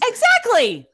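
high, loud cry of agreement; real excited shout of vindication; emphatic agreement. Real, not acted. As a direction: pounce on the point at full voice. Seizes on listener's point.

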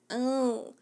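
lightly voiced whiny daughter; mock her asking for a sandwich; a hint of a pleading kid; daughter's voice. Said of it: "I don't know why I do my daughter's voice like that".

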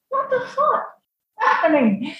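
very high, hushed disbelief; retold bewilderment. Wife hearing front door slam, retold high-pitched bewilderment. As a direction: squeak it out in baffled alarm.

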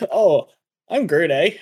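put-on loud, jokey Newfoundland accent; badly faked Newfie lilt. Friend copies the Newfie Groot attempt, badly faked Newfoundland accent. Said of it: "like a Newfie?".

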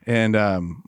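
ordinary delivery

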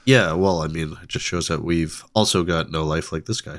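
ordinary talk, nothing marked

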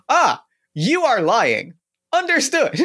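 loud, flat robotic announcement; loud monotone robot voice. Acted. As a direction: blurt it out like a malfunctioning robot. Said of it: "Very loudly in sort of the monotone robot voice".

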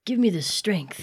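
slow, soft plea for strength; hushed appeal to heaven; mock prayer. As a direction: pray it quietly, drawn out. Listener joins the mock prayer.

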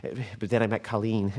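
low, soft, halting confession; subdued and defeated; downtrodden stammer. Acted. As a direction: stumble meekly through past glory. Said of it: "Just listen to yourself".

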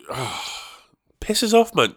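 normal talking voice

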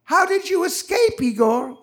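called out high and loud across a crowd; excited guest shouting to Igor; yelled question. Retold or imitated. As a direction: shout the question over the room. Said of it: "Somebody then yelled out".

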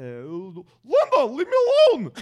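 loud, high, exaggerated dad voice; dad impression. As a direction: play an exasperated, hammed-up dad. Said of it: "So my dad would sound like".

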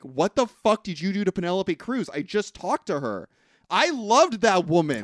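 furious accusation, playfully overdone; mock angry yell. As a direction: yell at him like a grieving friend. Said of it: "Jason Lee's like".

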